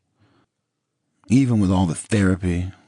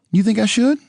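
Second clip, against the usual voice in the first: Nicole's voice; 5 dB louder than usual. A narrator's reading.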